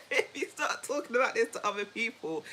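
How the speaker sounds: words broken by real laughter; laughing. Real, not acted. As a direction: talk through a laugh. Laughing at her own story.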